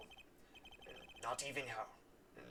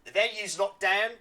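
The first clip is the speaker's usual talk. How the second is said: put-on "The venue's locked down" in a louder Australian accent; 4 semitones higher, 9 dB louder than usual. Officer reports the lockdown in an Australian accent. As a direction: give "locked down" an Aussie twang, loud and bright. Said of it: "you strike me as an Australian".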